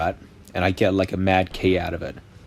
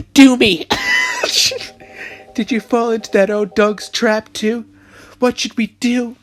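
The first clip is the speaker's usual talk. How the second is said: man doing a woman's voice; 12 semitones higher than usual. Acted. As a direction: play the little sister. Continues voicing the sister.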